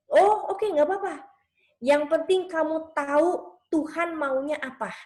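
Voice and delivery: high, easygoing mom reply; imitated accepting mother. Mother to a child not ready to apologize, lightly reassuring.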